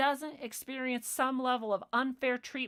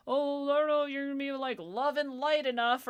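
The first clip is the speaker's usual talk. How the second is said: louder, higher put-on voice of a prissy caller; mocking caller; 5 semitones higher, 6 dB louder than usual. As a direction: parody the caller's sweet doubt. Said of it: "They're like".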